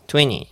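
"twenty" said as "tweny", no T burst; T dropped. Shown as a demonstration. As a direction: skip the T release in "twenty". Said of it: "もうTの破裂いらないんです". (Tの破裂なしの「twenty」)

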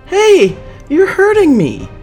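very high, loud squeal of protest; shrill, loud yelp; pained cry. A narrator's reading. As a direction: cry out as if your hand is being crushed. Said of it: "Angelica cries".

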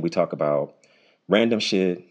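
ordinary talk, nothing marked

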